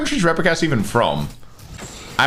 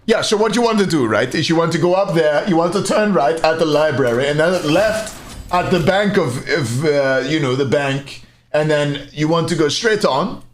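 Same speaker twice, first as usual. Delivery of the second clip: imitated helpful German, pitched a little up; hammed-up German modestly speaking fluent English; mock German accent; 3 semitones higher than usual. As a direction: give directions in a German accent. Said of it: "like, with German people".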